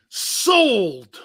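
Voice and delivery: loud, drawn-out closing call; auctioneer's sold. Pretend. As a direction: bang the gavel with your voice.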